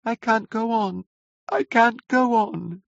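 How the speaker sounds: put-on high, thin child's wail; weeping. A narrator's reading.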